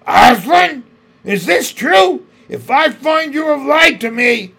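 the witch raging at the news; furious queen. A narrator's reading. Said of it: "cried the queen".

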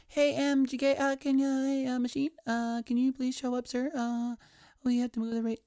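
high, squeaky put-on phone voice; mock timid official on a call; hesitant caller. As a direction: plead politely in a silly high voice. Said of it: "I'm sure they went and called".

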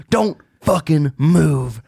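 slow, menacing order; a hint of a man holding a gun; armed man. Man at the camper holds him at gunpoint, slow threat. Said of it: "dude with a fucking gun ... goes".

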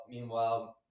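normal talking voice

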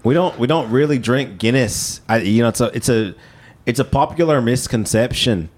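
measured mock Irish brogue; put-on Irish accent, halting delivery. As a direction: explain patiently as an Irishman. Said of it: "in an Irish accent".

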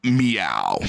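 deep, drawn-out cat meow; lightly voiced cat sign-off; low mock meow. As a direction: end the bit with a low meow. Cat's closing meow.

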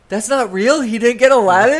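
mock disbelief. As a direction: play up the outrage of the dinner guests. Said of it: "people at his birthday dinner going".

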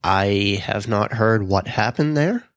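ordinary talk

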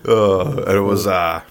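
ordinary delivery

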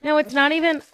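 talking while crunching chips; words over a mouthful of chips. Real, not acted. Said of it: "sorry for the crunch".

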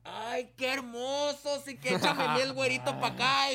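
chillido agudo y lento de muchachas; mujeres gritando. Retold or imitated. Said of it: "las mujeres empezaron a gritar". (imitando a las muchachas emocionadas, agudo)

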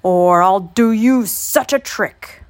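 loud, slow threat; old man's cry. A narrator's reading. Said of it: "cried the old man".

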